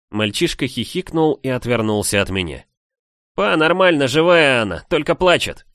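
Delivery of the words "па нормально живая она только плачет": put-on high, loud boyish shout; boy calling out. A narrator's reading. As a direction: report back to dad in a raised voice. Boy calls back to his father. (мальчишеский звонкий окрик отцу)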